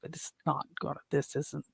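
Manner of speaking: quietly thinking it's pointless; inner thought. Retold or imitated. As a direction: mutter it as a thought. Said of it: "I thought to myself".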